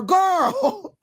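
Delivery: high, slow, laughing aside. Real, not acted. Host laughs about a company that keeps coming back, laughing and slow. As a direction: say it through a laugh. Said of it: "I'm laughing".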